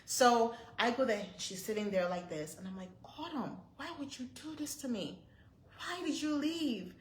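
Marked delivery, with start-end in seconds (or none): 3.2-6.9 pleading reproach. Retold or imitated. Mother reproaching found child.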